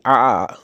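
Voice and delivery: strained आ from a squeezed throat; choked throat sound. Shown as a demonstration. Teacher imitates being choked to locate the sound. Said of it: "जब वो आवाज़ निकलती है".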